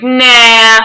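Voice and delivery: loud, drawn-out mock refusal; joking refusal. Fan kidding the signer who offers it back, joking loud refusal. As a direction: blurt it out as a joke.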